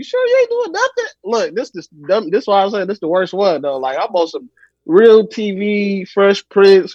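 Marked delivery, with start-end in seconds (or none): 0.0-1.0 put-on high, suspicious old-lady voice; grandma searching the room, high voice. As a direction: play the doubting grandma, high. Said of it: "She looking around like".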